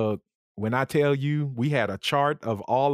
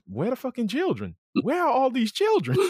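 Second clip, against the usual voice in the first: higher-pitched comic puzzlement; mock bewilderment; 10 semitones higher than usual. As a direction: wonder aloud in mock outrage, pitch climbing. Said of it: "And I always wondered".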